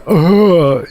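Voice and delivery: loud, high grunt of disgust; sharp, high disgusted ugh. Retold or imitated. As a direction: let out a mock ugh of contempt. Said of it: "like that".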